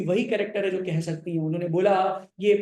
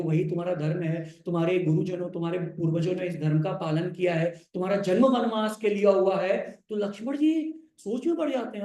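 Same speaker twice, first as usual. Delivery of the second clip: normal talking voice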